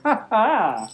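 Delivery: ordinary delivery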